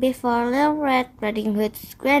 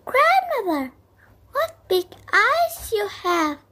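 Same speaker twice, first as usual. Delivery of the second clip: put-on much higher innocent girl; little girl voice; 9 semitones higher than usual. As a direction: ask wide-eyed like a small girl. Girl speaking to disguised wolf.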